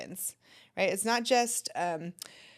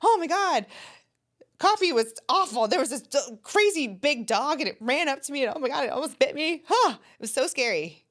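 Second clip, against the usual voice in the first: higher, louder, breathless mock-frightened retelling; retelling a scare; 6 semitones higher, 4 dB louder than usual. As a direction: play it up like the dog nearly got you. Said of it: "You two would have really different stories".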